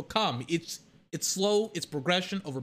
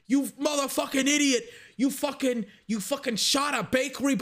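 higher, lightly voiced mobster yelling; mock Tony Soprano; 7 semitones higher than usual. Tony Soprano yelling at Christopher in the car: mild mock mobster yell. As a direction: ham up a mob boss scolding, lightly. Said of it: "I can't do the Tony Soprano accent".

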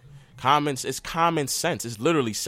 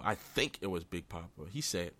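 normal talking voice

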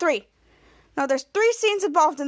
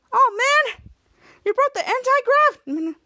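high, drawn-out complaint; retold "oh, man" whine; acted dismay; 8 semitones higher, 4 dB louder, slower than usual. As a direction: protest it in a raised, slowed voice. Retelling character's dismayed reaction.

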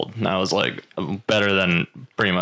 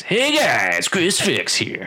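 YouTuber intro voice; 8 semitones higher, much slower than usual. Retold or imitated. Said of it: "He always goes".

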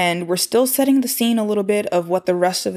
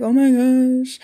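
mock gasp; 5 semitones higher, 3 dB louder, slower than usual. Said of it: "they're like".

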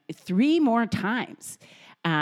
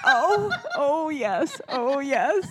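high, giggling oh yes; mother realizing she meant circumcision; laughing realization; 7 semitones higher, slower than usual. Retold or imitated. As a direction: laugh as it dawns on you. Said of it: "I'm like".

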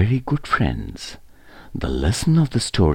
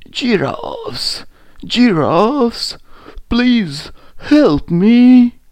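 high, loud pleading call; trapped lion calling desperately to passers-by; crying out; 11 dB louder, much slower than usual. A narrator's reading. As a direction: cry out for help, stretching the last plea. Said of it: "He cried".

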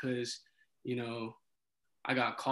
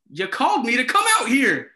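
raised, louder indignant comeback; indignant retort; 8 semitones higher, 6 dB louder than usual. Retold or imitated. RA to police who called him, indignant retort. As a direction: throw it back in retold outrage. Retells arguing back at police.

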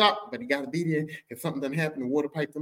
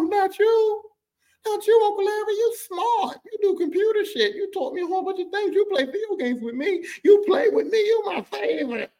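very high, pleading wail of betrayal; a kid discovering his favorite uncle is on crack; shocked disbelief; 5 dB louder than usual. Retold or imitated. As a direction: beg it not to be true. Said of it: "And I'm like".

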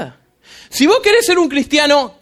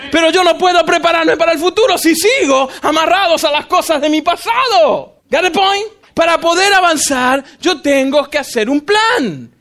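high, forceful exhortation; impassioned preaching; 5 semitones higher than usual. Real, not acted. Preacher urges congregation to let go of bitterness, preaching forcefully. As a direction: drive the lesson home to the congregation.

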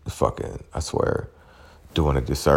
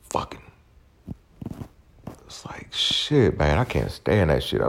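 ordinary delivery

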